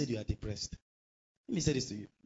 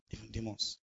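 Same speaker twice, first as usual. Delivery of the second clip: low, much softer echo; hushed repeat; 4 semitones lower, 9 dB quieter than usual. Real, not acted. Preacher warns listeners who else is listening, hushed. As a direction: drop it to a grave aside.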